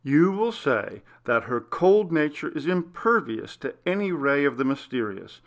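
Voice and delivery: plain reading voice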